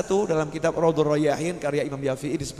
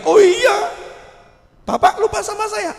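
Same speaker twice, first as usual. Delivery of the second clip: much higher, louder eager plea; the dragged sinner begging the pious man; pleading high voice; 7 dB louder than usual. Acted. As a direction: beg him to remember you.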